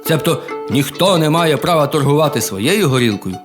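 usual speaking voice

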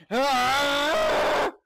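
long, high, loud scream; sustained yell climbing high; battle scream. Real, not acted. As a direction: scream "Ah!" full out at the enemy. Attacking the fake bunny.

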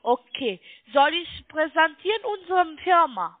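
ordinary talk

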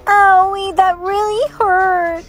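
high, slow whine of pain; hurt child. Acted. Child hurt after tripping, tearful whine. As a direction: wail it like a child about to cry.

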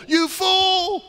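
high, ringing shout of rebuke; stern rebuke. Retold or imitated. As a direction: thunder the rebuke like a prophet. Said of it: "says to us through the parable".

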